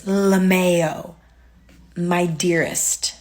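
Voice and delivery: loud, slow, smug "LaMayo, my dearest"; smug mock read of his message; mock condescension. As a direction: drag it out like a smug texter. Mocking his condescending text aloud.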